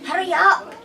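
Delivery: put-on high, loud urgent boy's bark; growling village boy. A boy urging his friends at the river, loud urgent bark. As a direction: hiss at your friends to hurry. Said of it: "a boy growled".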